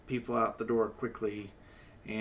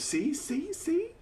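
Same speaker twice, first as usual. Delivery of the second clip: very high, thin little voice; squeaky high voice; slower than usual. Acted. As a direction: pipe it up really high, teasing. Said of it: "And then she goes".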